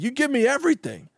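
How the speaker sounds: lightly mocking divorce-settlement demand, high then dropping; divorcing wife. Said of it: "Trump's being the wife of the divorce".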